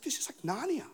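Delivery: high, soft, whispery awe; acted childlike amazement, half-whispered; hushed wonder. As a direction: breathe it out like a child in a magic land. Acts child awed by garden.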